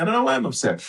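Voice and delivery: very high mock dismay; upset bewilderment. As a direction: act out baffled upset in a high voice. Voicing someone upset without knowing why.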